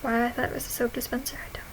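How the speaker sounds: whispered. Real, not acted. Confessing a dumb moment.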